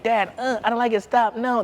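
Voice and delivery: mild mock child's whine; imitating crying kids. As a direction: play the kid begging dad to stop, half-hearted. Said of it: "They're crying".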